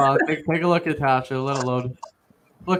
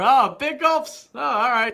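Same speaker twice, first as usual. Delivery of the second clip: movie quote; 7 semitones higher, 3 dB quieter than usual. Retold or imitated. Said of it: "you pulled the Dumb and Dumber".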